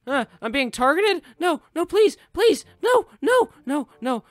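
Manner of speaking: very high, loud desperate begging; panicked pleading. Real, not acted. Gamer begging not to be hit by an item, panicked squeal. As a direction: beg in a frantic squeal. Being targeted in race.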